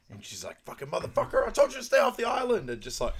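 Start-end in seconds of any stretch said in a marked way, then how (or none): 0.6-2.7 high, loud imitated outburst; acted angry yell. Furious woman confronting her ex on the island, imitated yelling. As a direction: let rip at him, voice shooting way up.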